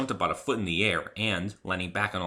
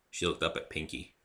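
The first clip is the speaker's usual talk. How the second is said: ordinary delivery, nothing marked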